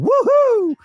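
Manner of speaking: very high, loud woohoo; yelled whoop. Acted. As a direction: whoop it out while running off.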